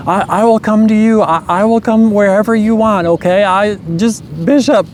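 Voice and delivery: a pleading mother, pitched well up; imitating eager mom.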